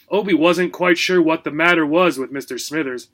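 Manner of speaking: ordinary delivery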